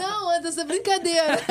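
loud, laughing through the words. Real, not acted. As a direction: laugh while you protest. Joking caught by Anderson.

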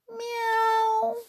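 high, drawn-out meow; kitten's meow. Acted. Lost kitten calling out in the rain, high thin meow. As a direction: mew like a little kitten far away.

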